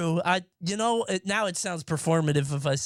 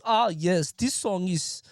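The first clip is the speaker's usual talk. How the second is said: mock German techno fan; slower than usual. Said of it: "It's just".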